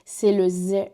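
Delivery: ordinary talk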